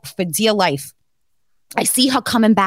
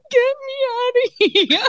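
much higher, half-crying, half-laughing; laughing panicked plea; 13 semitones higher than usual. Retold or imitated. Retelling her panic to friends, a laughing desperate plea. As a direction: beg to get out, giggling through the fear. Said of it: "I'm like".

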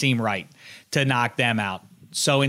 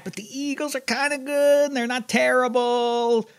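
much higher, stretched out as a reluctant allowance; grudging concession; 12 semitones higher than usual. Real, not acted. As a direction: concede it in a high, reluctant singsong. Grudging concession while weighing Philadelphia.

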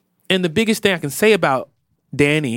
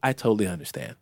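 calm; 7 semitones lower, 9 dB quieter, much faster than usual. Retold or imitated. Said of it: "his voice is all, it's even calmer".

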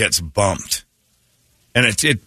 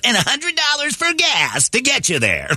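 mock announcer; 13 semitones higher than usual. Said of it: "and they goes".

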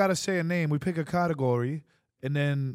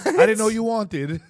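imitating Melanie, louder; 6 semitones higher, 9 dB louder, slower than usual. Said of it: "Melanie the crib always asks".

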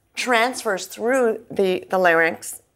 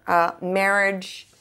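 ordinary delivery

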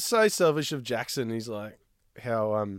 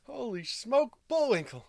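mock excited call; 8 semitones higher, 5 dB quieter, slower than usual. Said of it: "that's what he said".

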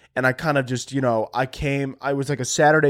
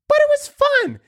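squeaky voice; 5 dB louder than usual. Acted. Sudden squeaky aside.

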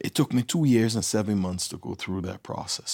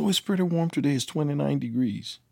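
high-pitched imitation of a breezy host mom; imitated chirpy woman, pitched up; cheery host mom; 7 semitones higher than usual. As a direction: report the weather as an untroubled host mom. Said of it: "Her host mom came on the phone".

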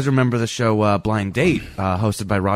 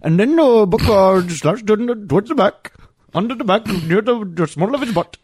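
high-pitched, put-on Indian accent; exaggerated Indian voice, pitched way up; comic Indian-accented commentary, high and loud; mock Indian accent; 12 semitones higher, 4 dB louder than usual. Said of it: "I can do a decent Indian voice".

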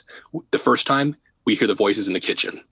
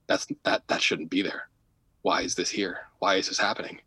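hushed murmur; 3 semitones lower, 7 dB quieter than usual. Retold or imitated. Clairvoyant repeats it at husband.

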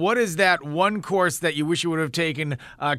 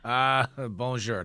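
slow, low sheepish French; low, slow hesitant French accent; French on request; 8 semitones lower, 4 dB quieter, much slower than usual. Shown as a demonstration. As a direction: offer the one word you know, slowly. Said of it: "Give us a little French".

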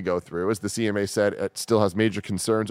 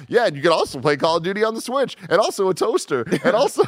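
mock corporate hype; 9 semitones higher, 4 dB louder, faster than usual. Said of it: "Xbox is like".

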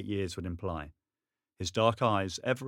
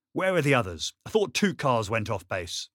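louder, demanding questions; annoyed; 3 semitones higher, 6 dB louder than usual. Acted. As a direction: press him, reddening with anger. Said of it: "his scowl".

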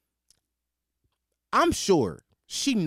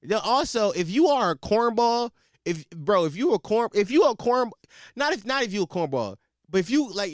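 loud call-out; 6 semitones higher, 5 dB louder than usual. Real, not acted. Calling out to audience.

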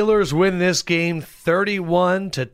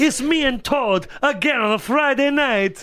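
high-pitched mock Italian whine; Luigi impression; 8 semitones higher than usual. Host as Luigi stuck with Todd, high Italian voice. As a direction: complain in a cartoon Italian voice. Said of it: "high-pitched Italian voice".

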